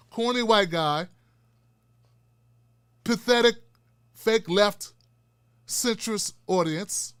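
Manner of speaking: ordinary talk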